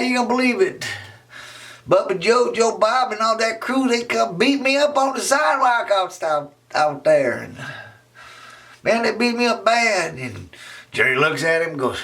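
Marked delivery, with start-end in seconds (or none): 0.0-10.1 put-on high, pleading kid voice; high upset boy. Raymond tells Jerry about the beating, high and aggrieved.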